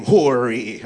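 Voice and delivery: "Hori" with a heavy H in front; added H. Acted. Policeman misreading comedian's name, "Hori" with added H. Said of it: "There's no H at the beginning of my name".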